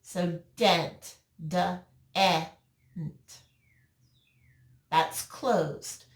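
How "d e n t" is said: "D-E-N-T" as separate letter sounds, duh, eh, n-t; segmented "D-E-N-T", pausing between the sounds; sounded out. Shown as a demonstration. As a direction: sound out "D-E-N-T" one sound at a time. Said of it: "you hear the vowel".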